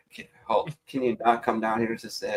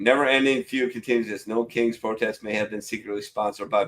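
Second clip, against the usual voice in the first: ordinary talk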